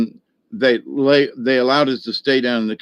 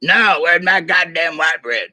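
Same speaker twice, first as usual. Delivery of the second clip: imitating the Sarge; 5 semitones higher than usual. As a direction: do the Sarge grumbling about his bread. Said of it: "He talked like that for real".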